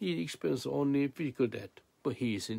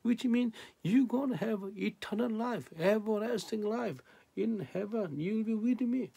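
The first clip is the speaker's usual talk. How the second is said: preaching at full force; 13 semitones higher, 4 dB louder than usual. Acted. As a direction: proclaim the promise in a sustained high voice.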